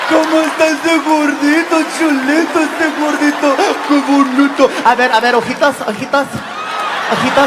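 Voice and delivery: high, loud cooing with laughter; doting taco lady. Retold or imitated. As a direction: fuss over him sweetly. Affectionate women at taco truck.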